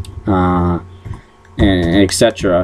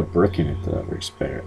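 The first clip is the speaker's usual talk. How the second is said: whispered aside; 5 semitones lower, 11 dB quieter than usual. Real, not acted. Muttered joke aside about co-host.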